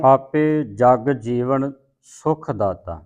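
ਜਗ ਜੀਵਨ drawn out slowly with added weight; weighted pronunciation. Shown as a demonstration. Teacher correcting a student's reading, heavy slow ਜਗ ਜੀਵਨ. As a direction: lean heavily on ਜਗ ਜੀਵਨ, slow. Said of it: "ਜਗ ਜੀਵਨ ਤੇ ਅਧਿਕ ਲਾ ਕੇ ਬੋਲਣਾ".

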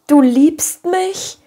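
high, slow, rising question; overwhelmed, wondering exclamation; amazed cry. A narrator's reading. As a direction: ask it in drawn-out disbelief. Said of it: "rief Anne mit den Händen ringend".

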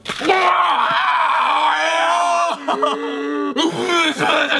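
long, high laughing shriek; laugh-scream as it hits. Real, not acted. Sardine hits his face.

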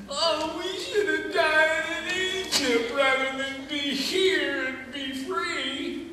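high, slow, sing-song whining; whiny Israelites. Acted. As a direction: moan it like a grumbling crowd. Israelites complaining in the desert.

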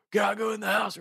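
lightly voiced drunk, pitched high; imitated drunk friend. Drunk friend asking his buddies to get inside, high-pitched imitation. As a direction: mimic a wasted guy's high-pitched request.

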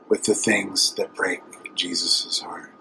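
slow, soft whisper; almost no voice, tender. Acted. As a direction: let it fall to a hushed breath. Sermon climax, tender line.

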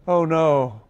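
mild bawl. A narrator's reading. Unicorn losing his colors, mild mock bawl. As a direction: wail it out lightly. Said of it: "bawled the unicorn".

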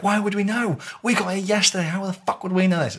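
high-pitched retold protest; acted-out high indignation; indignant protest. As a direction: protest innocence, voice pitched right up. Acting out accused crew protesting.

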